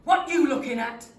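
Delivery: very loud, confrontational grunt; rough, aggressive demand; grunted challenge. A narrator's reading. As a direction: bark it at a staring stranger. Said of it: "and he grunts".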